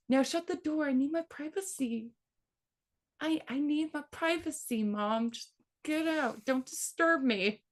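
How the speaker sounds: put-on high, soft little-boy demand for privacy; bossy kid. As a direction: shoo mom out like a prim little boy. Son demanding privacy, retold.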